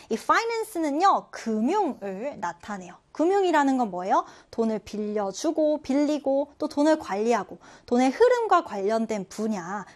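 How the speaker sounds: ordinary talk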